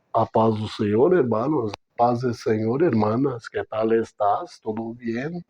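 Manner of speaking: church-greeting voice. Acted. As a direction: put on the gentle voice of a churchgoer greeting the brothers. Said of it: "mudamos a entonação de voz".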